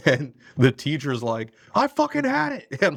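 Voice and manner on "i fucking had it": high-pitched hammed-up fed-up teacher; mock teacher outburst. Piano-lab teacher fed up with the class, high mock outburst. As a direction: play the teacher snapping, voice jumping way up. Teacher losing temper, retold.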